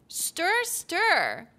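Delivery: high, swooping synthetic recipe narrator; TikTok text-to-speech. Shown as a demonstration. Streamer parodies recipe videos for chat in high text-to-speech voice. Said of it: "doing the TikTok text-to-speech one".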